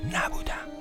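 a breathless whispered denial; whispering. Acted. Insisting he was not guilty.

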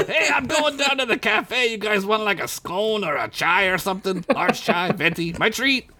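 very high, squeaky, chirpy offer; cheerful little pirate voice; tiny clam pirate. A narrator's reading. Clarence offers the heroes chai, squeaky and chirpy.

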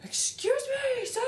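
high prim voice. Retold or imitated. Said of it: "She's like".

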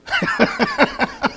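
sudden squeaky laugh; high burst of laughter. Real, not acted. Preacher laughing at his own kayak story. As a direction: laugh out at the joke.

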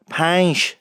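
پنج ending in sh, "pansh"; Isfahani pronunciation. Shown as a demonstration. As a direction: draw out پنج and close it on sh.

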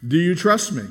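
lightly boomed, imitating a voice from overhead; thunderous voice. Voice overhead questioning the dangling man, mock thunderous. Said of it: "there was a thunderous voice".

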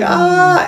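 high yell of someone falling; a sudden high "Ah!" scream. Retold or imitated. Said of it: "they're yelling".

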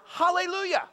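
hallelujah sung out high and loud; singsong hallelujah. Shown as a demonstration. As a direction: lift hallelujah up high and ring it out. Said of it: "that's the Hebrew word".

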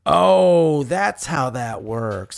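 sarcastic slow "oh", then drawn out; slowly dawning sarcasm; mock realization. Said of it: "And I was like".

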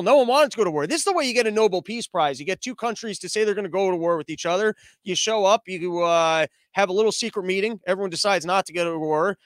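normal talking voice

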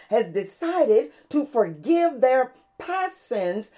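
high, ringing sermon delivery; impassioned proclamation about God; exultant preaching. Real, not acted. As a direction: proclaim it from the pulpit with rising fervour. Preaches the lesson.

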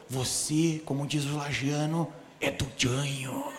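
voz grave e lenta do camarada; imitando o camarada. Retold or imitated. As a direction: faz o rapaz com a voz baixa e arrastada. Imitating the man, deep voice. (voz grave imitando o visitante)

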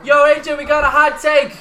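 high, loud, slowed hype call; excited announcing. Real, not acted. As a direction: call it out like breaking news. Announcing the surprise loudly.

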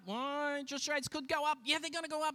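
high-pitched imitation of rumour-mongers; mock hearsay chatter. Voices of morning hearsay about rates, high mock worry. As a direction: pitch it up like passing on alarm. Mimics anxious daily hearsay.

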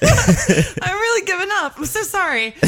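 high-pitched apology through laughter; helpless laughter. Real, not acted. Apologizing to co-host for losing the thread, helpless laughter. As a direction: give up, cracking up.

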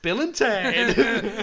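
slow, loud, laughing Bill and Ted; drawn-out excited echo. Pretend. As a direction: stretch it out like a stoner dude. Echoing the title, drawn out.

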